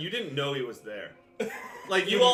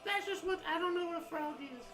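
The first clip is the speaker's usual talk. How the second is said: small, high, softer creature voice; childlike wonder; 11 semitones higher, 9 dB quieter than usual. Acted. Character answers how they know that, childlike wonder. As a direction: squeak it softly and innocently. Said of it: "a sense of childlike wonder".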